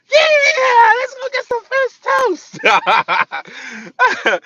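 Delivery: piercingly high, loud mock squeal; excited girl impression. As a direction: shriek it in overjoyed falsetto. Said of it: "she do talk like that".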